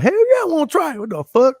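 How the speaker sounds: retold thrilled yes; eager excitement. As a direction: jump at the offer. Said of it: "I'm like".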